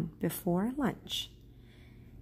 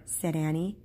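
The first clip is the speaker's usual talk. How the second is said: plain reading voice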